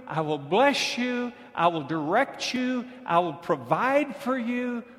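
high, loud preaching build; full-force sermon climax; fiery preaching. Acted. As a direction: drive it home to the congregation.

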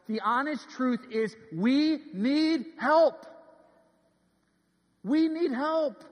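slow, high, loud pulpit cry; emphatic preacher's appeal; impassioned plea. Real, not acted. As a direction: stretch each word and ring it out. Preacher's climactic plea for help.

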